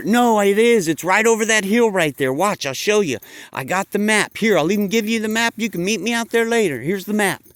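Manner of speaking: he's found freedom and can't wait to share it; eager Bob. Acted. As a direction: sheep urging friends out of the pen. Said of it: "Bob answers the sheep".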